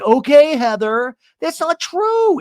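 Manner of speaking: high-pitched imitation of a meek yes; hammed-up giving in, then protest; mock obedient reply. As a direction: lift it high and exaggerate. Acted mocking of friend's meekness.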